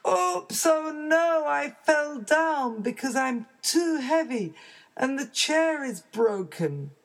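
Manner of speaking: high dismayed cry; startled oops. Acted. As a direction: caught breaking the chair. Chair breaks.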